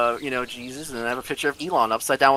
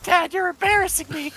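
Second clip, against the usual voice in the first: put-on embarrassed kid voice; 14 semitones higher than usual. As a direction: play the mortified son pleading with dad. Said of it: "your son's over there like".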